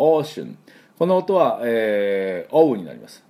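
ordinary talk, nothing marked

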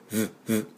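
"V" as a short soft lip buzz; soft isolated v buzzes; phone-vibration v. Shown as a demonstration. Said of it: "携帯バイブで終わる。".

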